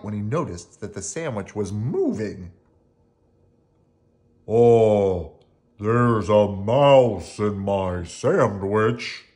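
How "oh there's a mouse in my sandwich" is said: loud, very slow giant voice; roaring giant. Acted. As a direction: boom it out slowly like a giant. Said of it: "roared the giant".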